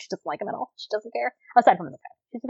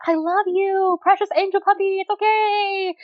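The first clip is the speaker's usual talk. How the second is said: put-on high, sweet baby talk to a puppy; cooing to a puppy; 10 semitones higher than usual. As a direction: coo it like soothing a sweet puppy. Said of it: "and I was like".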